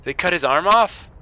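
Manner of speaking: high loud shocked exclamation. Real, not acted. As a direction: gasp it out in real shock. Shocked by game event.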